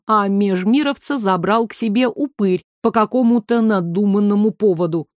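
ordinary delivery, nothing marked

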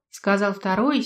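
usual speaking voice

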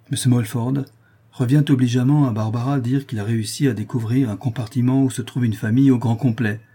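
usual speaking voice

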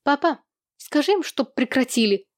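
put-on high-pitched girl's complaint; shrill cry. A narrator's reading. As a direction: whine up high to father. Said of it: "визгливо крикнула Луиза".

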